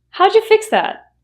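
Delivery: How'd you fix that with howja, pitch lifted; reduced question. Shown as a demonstration. Asking a colleague about the copier, reduced. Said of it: "you can ask".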